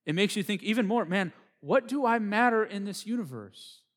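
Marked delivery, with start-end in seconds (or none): 1.0-3.8 raised, louder cry of wonder; overwhelmed wonder. Acted. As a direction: exclaim it high and loud, as if dwarfed. Acting out an overwhelmed person's thought.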